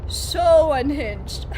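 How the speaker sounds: SO stretched long and loud in "so unhinged"; drawn-out emphasis. Real, not acted. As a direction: lean hard and slowly on "so". Emphatic recall of wild stream.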